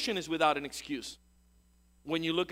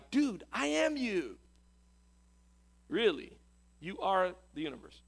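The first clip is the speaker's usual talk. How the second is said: casual smiling reply; 2 semitones higher than usual. Retold or imitated. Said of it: "smile up at the universe and reply".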